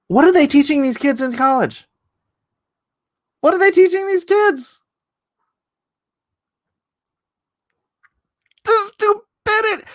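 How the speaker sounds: high-pitched disbelieving outcry; exasperated outburst. Real, not acted. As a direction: wail at the absurdity. Exasperated outburst about college.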